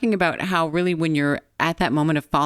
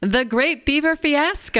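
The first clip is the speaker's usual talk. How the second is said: announcing; 8 semitones higher than usual. Pretend. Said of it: "But do it one more time".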